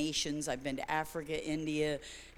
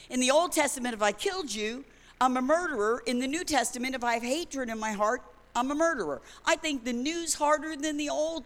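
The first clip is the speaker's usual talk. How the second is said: preaching at full force; 4 semitones higher than usual. Acted. Preacher pressing the congregation on the law. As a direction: drive the contrast home from the pulpit.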